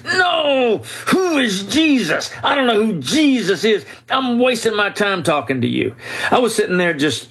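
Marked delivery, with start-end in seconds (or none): 0.0-6.0 high, strained furious yell; retold screaming. Angry professor yelling at the Bible reader. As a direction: shout him down in a rage, much higher. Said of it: "screaming at me at the top of his lungs".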